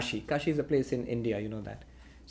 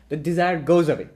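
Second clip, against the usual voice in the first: ordinary talk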